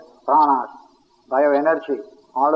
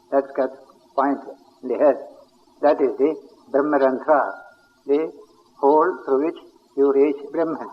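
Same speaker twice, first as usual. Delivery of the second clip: ordinary talk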